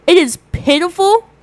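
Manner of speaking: loud dramatic declaration; loud lament. Pretend. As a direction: proclaim it to the camera. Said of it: "I'm gonna say that so the camera can catch it".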